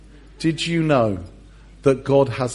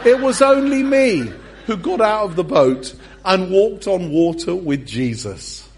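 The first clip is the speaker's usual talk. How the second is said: mock boastful Peter, louder and laughing; playing Peter; 6 semitones higher, 4 dB louder than usual. Peter teasing the disciples who stayed in the boat, hammed-up boast. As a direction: rub it in to the other disciples.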